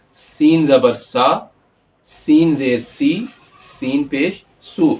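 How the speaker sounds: سین زبر سا with a hissed whistling s, slow. Shown as a demonstration. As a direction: draw out the s like a whistle. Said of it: "سیٹی کی آواز میں". (سیٹی کی آواز میں سین)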